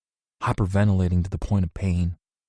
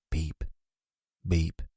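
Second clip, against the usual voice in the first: ordinary delivery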